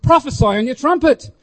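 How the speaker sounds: abrupt preacher's command, imitated. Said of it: "he was quite abrupt".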